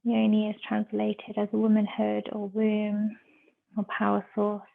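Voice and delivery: measured meditation voice with long pauses; guided meditation. Real, not acted. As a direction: guide them into stillness, phrase by phrase.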